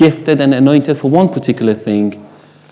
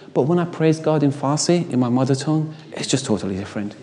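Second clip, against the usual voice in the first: hushed and heartfelt; 11 dB quieter than usual. Real, not acted. Preacher telling the congregation about his mother tongue, softly sincere. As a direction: drop right down to a soft, sincere near-hush. Tender aside about praying in Farsi.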